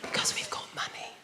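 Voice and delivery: breathy hushed whisper. Pretend. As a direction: whisper it like a secret. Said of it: "We don't like to raise our voices".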